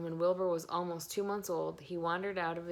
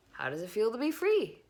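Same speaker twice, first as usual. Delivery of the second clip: 1 cheerful goose; 5 semitones higher, 5 dB louder than usual. A narrator's reading. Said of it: "she asked".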